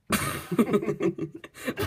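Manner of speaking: breathy, high giggling; real helpless little laugh; fit of giggles. Real, not acted. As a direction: crack up when it fails to switch on. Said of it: "désolé pour ces petits rires".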